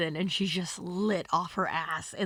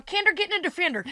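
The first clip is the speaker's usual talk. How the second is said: much higher, fast shouted order; high loud hurried summons; calling out; 11 semitones higher, 4 dB louder, faster than usual. Retold or imitated. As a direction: call across the party to Kendall. Host calling Kendall.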